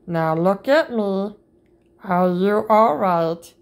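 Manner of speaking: ordinary delivery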